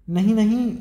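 high, loud desperate cry of no; scream. A narrator's reading. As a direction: scream it, begging. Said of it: "Oliver चीखा". (ऊँची घबराई चीख)

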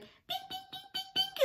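very high, chiming bell noises; ding like a phone alert; imitating a notification bell chiming. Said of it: "be like".

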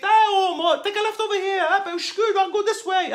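high, mock-fussy mother-in-law voice; high-pitched nagging mother-in-law from the back seat. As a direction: squeak out a bossy backseat driver. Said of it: "my mother-in-law's in the back seat".

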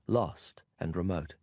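usual speaking voice

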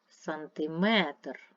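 slow Сантиметр, stretched on -метр; stress on third syllable. Shown as a demonstration. Teacher sorting words by stress, slow third-syllable stress on Сантиметр. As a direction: draw out the last syllable of Сантиметр. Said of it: "третій стовпчик".

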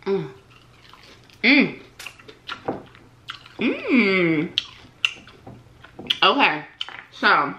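humming while chewing food; muffled closed-mouth chewing sounds; mouth full, chewing. Real, not acted. Seen 3: bites wing at start, lips closed chewing through the hums.

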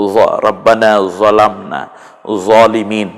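রব্বানা জলামনা said slowly, dhal-like জ; slow recitation. Shown as a demonstration. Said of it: "হালকা".

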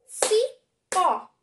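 "Cipó" loud, in two beats, Ci - pó; syllable by syllable. Shown as a demonstration. Teacher counts syllables for pupils, "Cipó" split into Ci, pó. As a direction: punch both syllables of "Cipó" separately. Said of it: "Duas sílabas".

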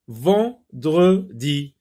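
Vendredi very slow and loud, syllable by syllable; syllabified slowly. Shown as a demonstration. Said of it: "Sépare les mots en syllabes". (Vendredi détaché très lentement)

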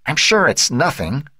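reassure, but let the worry leak through; tense, high-pitched mother on the phone; tense reassurance. A narrator's reading. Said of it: "her tense voice belying her words".

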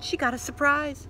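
plain reading voice

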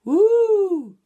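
very high, loud spooky woo; loud ghostly call; monster woo. A narrator's reading. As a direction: jump out like a monster. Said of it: "dress up as a monster".

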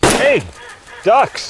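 high, loud call to point out the ducks; sudden alert call. Acted. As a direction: snap his attention to the ducks. Spotting ducks, alerting the shooter.

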